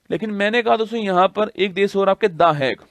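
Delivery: normal talking voice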